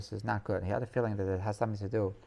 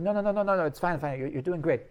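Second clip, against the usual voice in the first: imitating higher, insistent reassurance; hasty reassurance; 5 semitones higher, 5 dB louder than usual. Rebbe urging the worried jester on, insistently reassuring. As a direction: wave away the apology eagerly.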